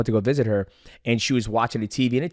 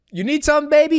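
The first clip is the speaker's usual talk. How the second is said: much higher, sweet motherly voice; imitating mom; 6 dB louder than usual. Mom answering her son's call, high sweet imitation. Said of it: "And she's like".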